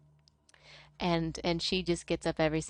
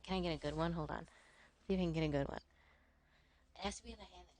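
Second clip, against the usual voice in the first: quiet imitation; 11 dB quieter, much faster than usual. Imitating the Southern streamer lining up a vape hit, hushed. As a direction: drop to a hush as if mid-vape.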